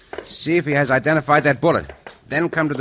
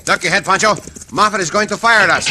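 urgent warning; 7 semitones higher, 5 dB louder, faster than usual. Acted. Warning partner under fire.

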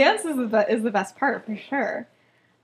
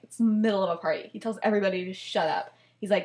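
normal talking voice

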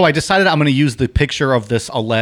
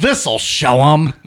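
loud, slow, raised mock-villain voice; gloating saboteur; 5 semitones higher, 7 dB louder, slower than usual. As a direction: draw it out loudly like a gloating prankster. Acts out gleeful saboteur.

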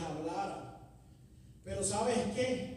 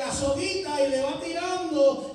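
full-force preaching; 6 semitones higher, 13 dB louder than usual. Acted. Preacher urging the youth, at full force. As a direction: drive it home to the congregation.

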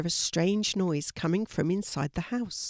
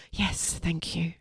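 breathy, slow whispered thanks; 4 dB quieter, slower than usual. A narrator's reading. Stacy answers Fiona as she relaxes, whispering. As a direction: sink back and breathe it out.

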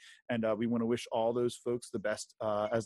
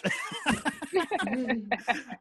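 high laugh; 10 semitones higher than usual. Real, not acted. Laughing after clickbait joke.

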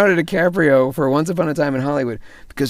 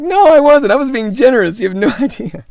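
high, loud mock outburst; exclaimed protest; 12 semitones higher, 11 dB louder than usual. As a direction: cry it out in exaggerated denial. Acted retort to the caller.

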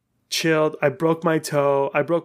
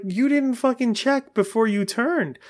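indignant retort; 8 semitones higher than usual. Retold or imitated. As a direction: replay an angry accusation. Acted quarrel with other cyclist.

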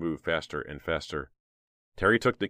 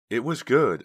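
raised, high, unsettled agreement; shaky voice; 7 semitones higher, 6 dB louder, slower than usual. A narrator's reading. Trisha to the prankster after a scare, shaky voice. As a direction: agree as if still rattled. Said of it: "agreed Trisha in a shaky voice".

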